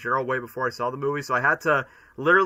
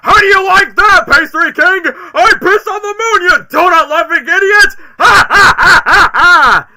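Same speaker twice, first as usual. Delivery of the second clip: shrieking, cackling mad scientist; manic villain shout; 16 dB louder than usual. Acted. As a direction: scream it like a gleeful maniac. Jim Carrey Gerald villain rant.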